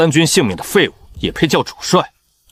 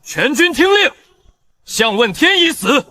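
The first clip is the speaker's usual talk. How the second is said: commanding shout; 12 semitones higher than usual. Acted. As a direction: announce to the whole army. Addressing whole army.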